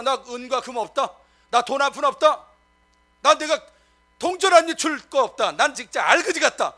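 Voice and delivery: mock boast. Mock boasting of poverty again.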